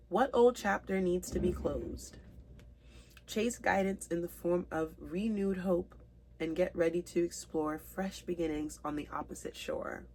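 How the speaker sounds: usual speaking voice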